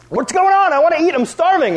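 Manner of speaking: high, loud whiny complaint; whiny protest. Retold or imitated. Little brother whining at his sister, high and loud. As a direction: whine like a starving little brother.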